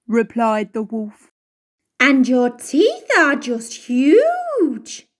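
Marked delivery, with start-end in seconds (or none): 2.0-5.1 slow, drawn-out alarmed exclaim; girl stretches out each word in alarm. A narrator's reading. Girl exclaims at the wolf's huge teeth, slow and startled.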